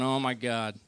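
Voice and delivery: low, soft eye-rolling groan; imitating exasperated wife. Wife reacting to husband's jokes, low exasperated aside. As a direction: mutter it low, mildly fed up. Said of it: "She's always rolling her eyes going".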